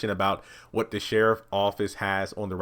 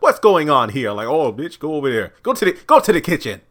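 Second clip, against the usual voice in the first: loud, raised-pitch telling-off; mock scolding; 6 semitones higher, 9 dB louder than usual. Elder Mother sending Lacey to the kitchen, mock scolding. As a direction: order her off, hammed-up and loud.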